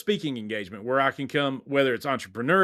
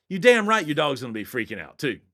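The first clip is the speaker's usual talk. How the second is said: ordinary talk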